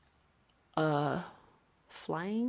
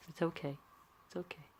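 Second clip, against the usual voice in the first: softer and softer, soothing a sad drawing; soft reassurance; 12 dB quieter than usual. Pretend. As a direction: hush it like consoling a friend. Comforting sad character in drawing.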